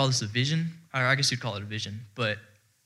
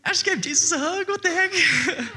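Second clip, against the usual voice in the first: very high, squeaky retold outburst breaking into laughter; happy-tears disbelief. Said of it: "I'm like, happy tears".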